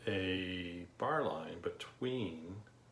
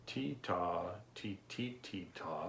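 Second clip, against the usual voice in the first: ti ta spoken on the beat; rhythm syllables; 3 dB quieter, slower than usual. Shown as a demonstration. Teacher showing ti ta counting to the class. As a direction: chant ti ta in strict time. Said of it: "which you might remember from your general music class".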